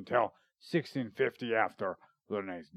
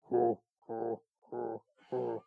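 soft hooting jug puffs; imitated moonshine jug; 4 dB quieter, slower than usual. Shown as a demonstration. Asking friend about the instrument, imitated jug hoots. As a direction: blow hollow hoots like a hillbilly jug. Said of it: "Like the moonshine jug?".

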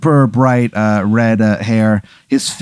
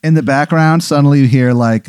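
ordinary delivery

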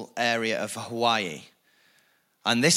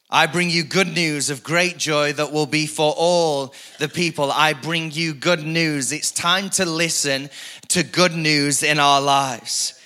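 louder, higher preaching drive; full-force preaching; 6 semitones higher, 6 dB louder than usual. Acted. Pastor rallying the church, full-force preaching. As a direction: preach it out to the congregation.

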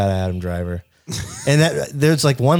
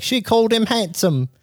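mocking friend voice; 10 semitones higher than usual.

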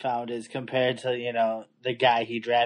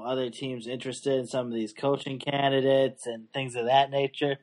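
usual speaking voice

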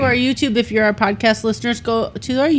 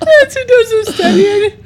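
laughing; 10 semitones higher than usual. Real, not acted. Laughing while answering.

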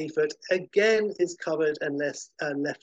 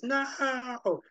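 a much higher scream of no; villain's scream; 11 semitones higher than usual. Acted. Ultra Humanite screams as his brain overloads, much higher scream. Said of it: "And he screams".

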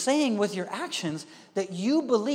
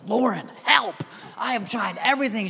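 high, loud frantic plea for help; desperate plea; 4 semitones higher, 4 dB louder than usual. Retold or imitated. Overwhelmed dad calling his wife for help. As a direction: beg for help, at wits' end.

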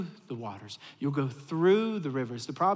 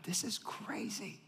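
slow hushed whisper of wonder; awed whisper; 5 semitones higher, 12 dB quieter, slower than usual. Retold or imitated. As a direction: whisper it, stunned by the sight. Awestruck under falling leaves.

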